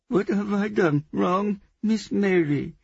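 high, loud, slow wailing sobs; sobbing child. A narrator's reading. Little girl missing her father, sobbing. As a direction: wail it slowly like a distressed child. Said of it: "she sobbed".

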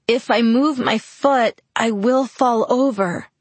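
slow and tense through gritted teeth. A narrator's reading. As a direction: force it out through clenched teeth. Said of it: "I told him through gritted teeth".

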